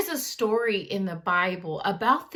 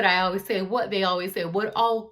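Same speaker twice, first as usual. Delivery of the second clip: ordinary delivery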